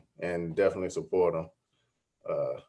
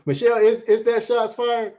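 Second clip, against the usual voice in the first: mock surprise; 14 semitones higher, 6 dB louder than usual. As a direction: play innocent and shocked. Mock shock at a jab.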